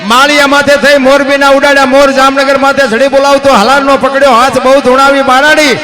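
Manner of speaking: rhythmic chanted verse recital, steady driving beat; bard's lilting verse delivery; folk poem recital. Acted. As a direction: recite the earthquake ballad like a village bard.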